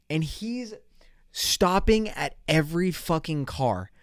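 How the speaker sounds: normal talking voice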